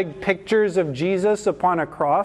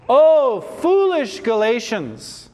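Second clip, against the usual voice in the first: higher, louder OH, then slow FOOLISH GALATIANS; very emphatic; 4 semitones higher, 3 dB louder, slower than usual. Acted. Apostle rebuking the Galatians, very emphatic. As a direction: cry it out as a drawn-out rebuke. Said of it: "This is very emphatic".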